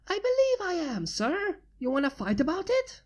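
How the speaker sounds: high teasing pony voice; smug Pinky. Acted. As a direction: taunt him playfully. Pinky teasing back smugly.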